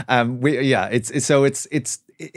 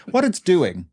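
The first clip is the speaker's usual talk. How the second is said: usual speaking voice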